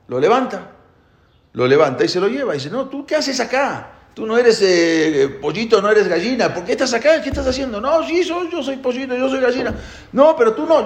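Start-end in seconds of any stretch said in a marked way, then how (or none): 2.7-7.8 a high-pitched eagle, doubtful and probing; eagle questioning chick. Acted. 7.8-9.7 very high squeaky little chick voice; insistent chick. Acted. Chick protests to the eagle, very high and insistent. 10.1-10.8 eagle contradicting. Acted.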